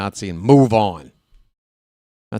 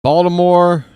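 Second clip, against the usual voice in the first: announcer boast; 6 semitones higher, 7 dB louder than usual. Acted. Boasting his travels, announcer.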